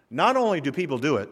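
ordinary delivery

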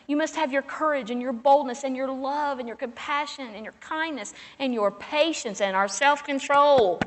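loud, high preaching cry; full-force preaching. Acted. Pastor's wife urging the congregation to speak up, preaching at full force. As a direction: drive it home to the whole church.

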